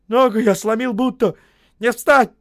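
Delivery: loud, high pained call; pained cry. A narrator's reading. Injured Osip crying out that his leg is broken. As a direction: cry it out in pain.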